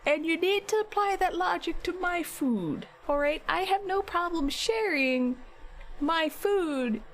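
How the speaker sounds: explaining to her partner why her food is hers; retold exasperation. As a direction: spell it out, mildly exasperated. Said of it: "It was exasperated".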